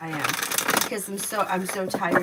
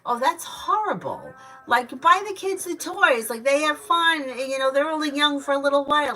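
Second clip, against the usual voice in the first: indignant inner thought; 7 semitones higher, 5 dB louder than usual. Retold or imitated. Recalling her reaction to the neighbor mom, mock indignant. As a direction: act out shocked disapproval. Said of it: "I remember thinking".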